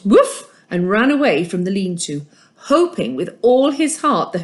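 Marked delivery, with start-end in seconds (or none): 0.1-0.5 high, loud single bark; one sharp dog bark; woof. A narrator's reading. Mister Dog barking at Hussan before running off, loud high woof. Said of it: "Mister Dog woofed".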